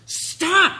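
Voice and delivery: sudden high, loud shout; fed-up full-volume yell; parent yelling. Acted. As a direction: explode at a child who won't listen. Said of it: "skip to the yelling".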